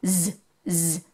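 soft buzzing "Z, z" as a long zzz; z sound. Shown as a demonstration. Teacher to children, a soft buzzing "z" sound. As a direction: buzz "z" quietly like a bee. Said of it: "z is the sound it makes".

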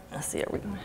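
unvoiced whisper to herself; whispered aside. Real, not acted. Searching for the next comment, whispered aside. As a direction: mutter it under your breath.

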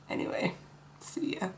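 soft whispered sign-off; whispered goodbye. Real, not acted. Whispering a quick goodbye to viewers. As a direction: breathe the goodbye quietly.

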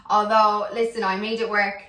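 normal talking voice

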